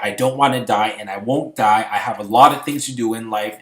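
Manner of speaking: determined. Retold or imitated. Vows survival in storm.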